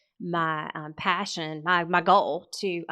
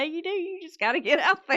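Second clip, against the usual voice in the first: much higher, amused agreement; amused echo; 9 semitones higher than usual. Real, not acted. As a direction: repeat the advice high, tickled by it. Laughingly echoes dad's advice.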